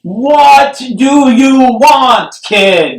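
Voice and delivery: hammed-up shouted question; mock shout. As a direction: yell it at the kid. Shouted movie scene.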